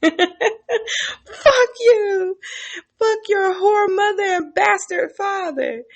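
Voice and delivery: high, laughing put-on captive voice; laughing mock curse. Captive cursing torturer.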